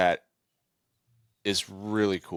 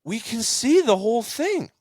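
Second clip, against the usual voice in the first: put-on high, protesting kid voice; young self; 12 semitones higher than usual. Boy protests to his father, high and whiny. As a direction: whine it like an exasperated boy. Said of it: "And I'm like".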